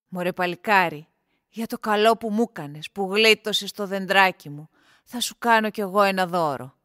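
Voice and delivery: low, loud character voice; hearty thanks in a deep voice, slowing at the end; grateful gardener. A narrator's reading. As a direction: announce a reward, deep and full-voiced. Grateful gardener rewards the young man.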